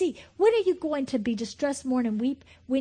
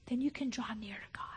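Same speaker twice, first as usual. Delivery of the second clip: whisper; 9 dB quieter than usual. Real, not acted. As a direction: drop to a hushed whisper. Hushed after shouted build.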